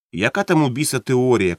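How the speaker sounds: ordinary delivery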